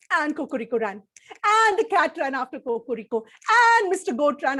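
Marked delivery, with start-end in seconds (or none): none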